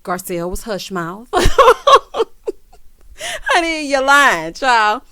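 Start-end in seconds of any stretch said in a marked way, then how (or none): 1.3-2.6 a loud, high cackling laugh; loud cackle. Real, not acted. Recapping the lie, she bursts into a loud high cackle. 3.2-5.0 higher and louder, full of sass; sassy callout. Acted. Host calls out the housewife's lie, loud and sassy.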